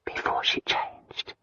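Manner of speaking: soft slow whisper. A narrator's reading. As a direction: breathe it out quietly. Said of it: "Christina whispered".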